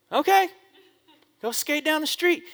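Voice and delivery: a breezy high mom okay. Retold or imitated.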